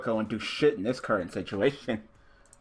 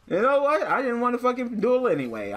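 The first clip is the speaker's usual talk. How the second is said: sulky dismissal; 11 semitones higher than usual. Real, not acted.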